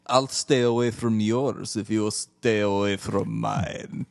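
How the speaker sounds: slow, low smug delivery; mock sleazy line. Cohosts quoting a cheesy line, slow and smug. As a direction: purr it like a cheesy villain. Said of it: "quoting the show line".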